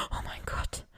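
breathless, toneless whisper of shock; voiceless whisper. A narrator's reading. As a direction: barely breathe the words out in horror. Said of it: "wisperte Vajo stimmlos". (stimmloses, erschrockenes Flüstern)

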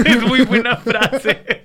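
laughing it out, slow; words pushed out through laughter. Real, not acted. Laughing with friend over a quote, slow. Laughing after quoting curse.

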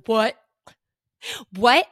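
loud disbelieving what; shocked shout. Retold or imitated. She hears Disneyland called, loud shocked outburst. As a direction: blurt it out stunned. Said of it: "I go".

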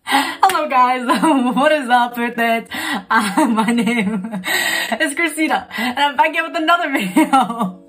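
breathless giggly restart after a laughing fit; after laughing hard. Real, not acted. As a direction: still catching breath from laughing. Let me collect myself.

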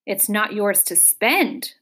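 ordinary delivery, nothing marked

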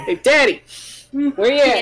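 hammed-up call for dad; joking call down the hall; mock call. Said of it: "playful calling".

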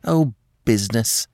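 a touch of dismissive scorn; scornful. A narrator's reading. Alex brushes off her husband's talk of business, mildly scornful. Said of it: "said Alex scornfully".

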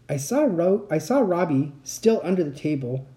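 ordinary delivery

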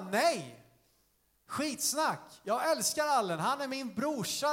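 high, emphatic refusal of the doubts; defiant rejection. Acted. Dan rejects the devil's lie about Allen, defiant. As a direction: throw the lie off with conviction.

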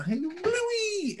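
imitating the cartoon intro's call; excited call of a cartoon name; show intro yell. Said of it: "I did the".

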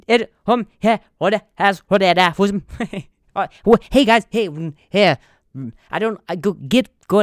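loud, stammering cartoon rooster bluster; Foghorn Leghorn. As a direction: sputter loudly in broken cartoon fragments. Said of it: "foghorn leghorn".

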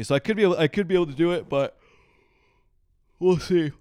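talking through a yawn, pitch pushed up; real yawning. Real, not acted. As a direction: let a yawn stretch the words. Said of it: "sorry, I'm yawning".

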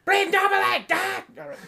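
high, loud mock German order; shouted German accent. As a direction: bark the instruction in exaggerated German. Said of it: "machine is made in Germany".

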